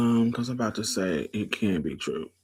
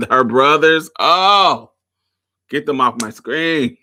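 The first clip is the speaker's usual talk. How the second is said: loud, raised-pitch exclamation; exasperated outburst; 4 semitones higher, 11 dB louder than usual. Real, not acted. Host reacting to the brothers on screen, exasperated outburst. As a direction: cry out in comic exasperation.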